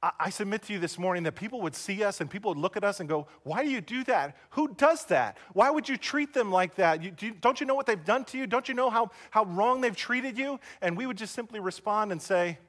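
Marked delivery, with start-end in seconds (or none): 3.4-10.6 high-pitched, quick disbelief; incredulous questioning. Retold or imitated. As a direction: challenge them as a baffled onlooker, voice raised high. Imagined onlookers question Christians' radical love.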